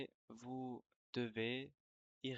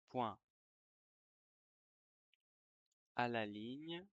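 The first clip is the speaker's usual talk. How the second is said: dictating. Shown as a demonstration. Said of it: "je vais dicter une dictée".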